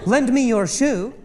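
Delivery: quick, a squirrel needs saving; urgent rescue plea. Retold or imitated. Wife urging husband to hand over his shoe, excited. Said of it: "she goes".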